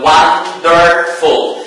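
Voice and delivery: WONDERFUL broken slowly into won-der-ful; syllable by syllable. Shown as a demonstration. Teacher showing three syllables, WONDERFUL said slowly in parts. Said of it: "we have three syllables".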